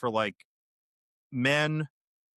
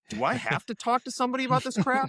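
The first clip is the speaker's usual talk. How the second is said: high-pitched, put-on dismayed question; mock reluctant guy; 10 semitones higher, faster than usual. Doctor voicing guys who wonder on their own, mock reluctant. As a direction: imitate a guy balking, pitched well up.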